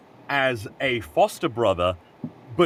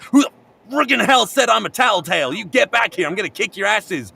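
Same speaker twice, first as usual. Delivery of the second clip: higher, louder hammed-up outrage; mock angry yell; 8 semitones higher, 4 dB louder than usual. Jonathan yelling at boys who insulted him, mock angry. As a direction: yell after the kids in mock fury. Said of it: "And he's like".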